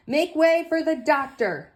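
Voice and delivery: high, loud call to the crowd. Acted. Luther pushing through the crowd, calling out loud. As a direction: clear the way with a shout.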